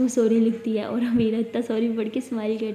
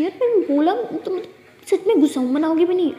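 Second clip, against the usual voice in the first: sulky anger; 6 semitones higher, 5 dB louder than usual. Acted. Girlfriend sulking, angry.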